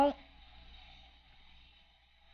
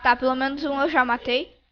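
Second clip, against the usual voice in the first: normal talking voice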